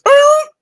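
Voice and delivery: loud, very high yelp; acted wolf yelp. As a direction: yelp sharply like a hurt dog. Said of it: "it just goes".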